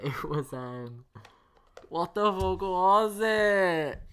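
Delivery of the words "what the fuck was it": loud, high, drawn-out exasperation; exasperated. Real, not acted. Co-hosts can't remember the song, loud exasperated wail. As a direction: stretch it out in frustrated racking of memory.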